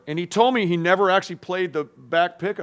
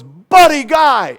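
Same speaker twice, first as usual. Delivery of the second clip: slow, much higher, loud shout of the name; 11 semitones higher, 14 dB louder, much slower than usual. Retold or imitated. Bonnie calling out to Buddy on stage, slow loud shout. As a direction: hail him across the stage. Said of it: "she goes".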